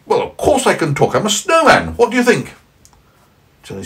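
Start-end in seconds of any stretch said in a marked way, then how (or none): 0.1-2.5 high, loud cheery snowman. Acted. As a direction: play the indignant snowman, high and bright. Parent voices the snowman.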